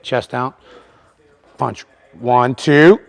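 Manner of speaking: loud, high calls to the lifters; coaching calls. Real, not acted. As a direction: keep cueing them through each rep. Coach cueing several lifters live.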